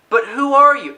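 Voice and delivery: high, loud, clueless mock question; mock Gordon. Reviewer mocking Gordon not recognizing Batman, high and loud. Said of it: "he's like".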